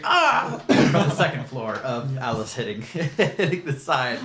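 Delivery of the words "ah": short high-pitched yelp; acted cry of pain. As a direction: cry out as if banged against the side. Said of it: "and you would hear".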